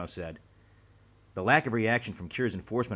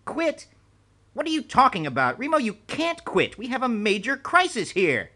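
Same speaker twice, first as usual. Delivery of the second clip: high, loud, panicked protest; a boss refusing to let his agent quit; higher and louder urgent pleading; alarmed disbelief; 14 semitones higher, 7 dB louder than usual. A narrator's reading.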